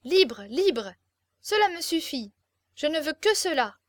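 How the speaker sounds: acted defiant outburst; rebellious. A narrator's reading. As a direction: demand it stubbornly, word by word. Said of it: "en pleine révolte".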